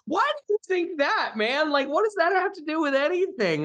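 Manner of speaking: high, incredulous "what does that have to do with anything"; incredulous retort. Retold or imitated. Retelling his reaction to the film's slur, incredulous high voice. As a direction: squeak up in disbelief at the insult. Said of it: "and I was like".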